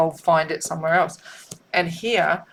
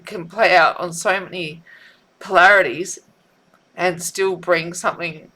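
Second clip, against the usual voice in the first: usual speaking voice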